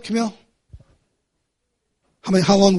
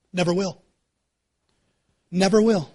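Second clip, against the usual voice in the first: ordinary talk, nothing marked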